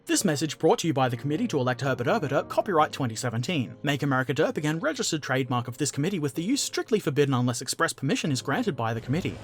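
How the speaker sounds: rattled off fast like a political ad disclaimer; fast, dry disclaimer read; mock ad disclaimer. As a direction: speed through the legal small print.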